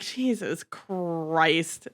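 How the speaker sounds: a quiet, very slow, weary "Jesus Christ"; exasperated sigh. Retold or imitated. Reader reacting to Edward's werewolf line, quiet and exasperated. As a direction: let it drag out, fed up.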